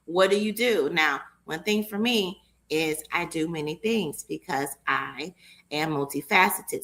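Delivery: ordinary talk, nothing marked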